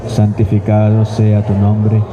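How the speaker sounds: ordinary talk, nothing marked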